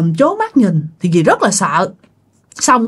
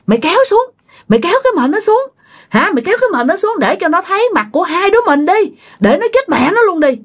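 high, fast, menacing ghost voice; yelling ghost woman; 10 semitones higher, much faster than usual. Acted. Ghost urges the other to uncover victims, high spiteful yelling. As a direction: cry out spitefully in a high pitch, without much volume. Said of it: "bà la làng lên".

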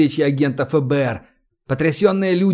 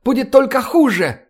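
high, urgent begging; desperate pleading; 9 semitones higher than usual. A narrator's reading. Professor rushing between commanders, high desperate plea. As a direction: plead frantically, pitch well up. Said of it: "взмолился Вессенберг".

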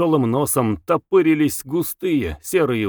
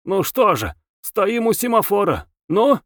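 high, challenging questioning; provocative, needling challenge; defiant tone; 8 semitones higher than usual. A narrator's reading. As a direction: demand an answer, pitch raised. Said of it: "говорил вызывающим тоном".

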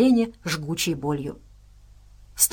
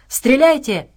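loud, raised-pitch command; acted shout to the squad; barked order; 6 semitones higher, 6 dB louder, slower than usual. A narrator's reading. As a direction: bark it out like an urgent order. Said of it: "гаркнул Абрамс".

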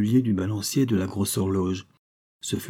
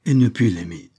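usual speaking voice

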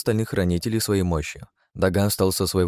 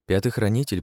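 plain reading voice

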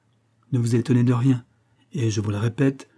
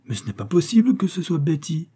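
higher, agitated protest; 6 semitones higher than usual. A narrator's reading. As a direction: insist it can't be her, flustered. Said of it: "dit Lady Farwell avec agitation".

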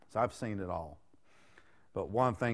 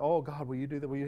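pleading prayer; 4 semitones higher, faster than usual. Retold or imitated. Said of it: "Why are we asking God to move?".

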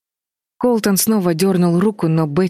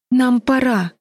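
high, voiced plea rather than whisper; desperate urging; 8 semitones higher, slower than usual. A narrator's reading. Betty urges escape as lanterns approach, desperate and high. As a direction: plead desperately with raised pitch. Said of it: "в отчаянии прошептала Бетти".

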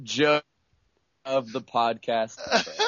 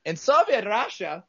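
higher mock Russian accent; 7 semitones higher, 3 dB louder than usual. Host launching a Soviet Russia joke, Russian accent. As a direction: start a joke in put-on Russian. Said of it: "so he has a Russian accent".

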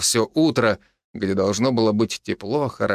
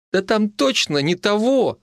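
high, flustered insistence; embarrassed pleading; 9 semitones higher than usual. A narrator's reading. Mitka begs the healer about his ailment, embarrassed. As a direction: plead awkwardly, pitch well up. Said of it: "густо покраснел Митяй".

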